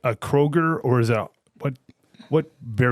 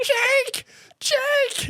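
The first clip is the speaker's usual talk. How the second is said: memanggil Jake dengan suara tinggi; calling Jake. Retold or imitated. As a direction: teriakan tinggi ala film. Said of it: "I'm like, when I looked at him".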